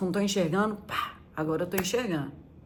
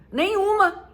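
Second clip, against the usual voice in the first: much higher firm reply; proud answer; 10 semitones higher, slower than usual. Retold or imitated. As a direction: toss back the answer in a high confident voice. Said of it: "Aí eu falo assim".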